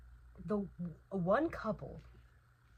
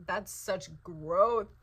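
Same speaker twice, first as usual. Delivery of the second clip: slow emphatic praise; 8 dB louder, much slower than usual. Real, not acted.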